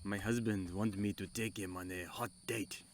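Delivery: low, soft, hammed-up Spanish accent; mock Spanish accent. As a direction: put on a playful Spanish accent. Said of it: "that's a little better".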